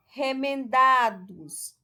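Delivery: Remendados slow, with DA loud and long; stress on DA. Shown as a demonstration. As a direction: sound out Remendados, leaning on DA. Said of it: "a sílaba tônica". (Remendados devagar, com DA forte e alongado)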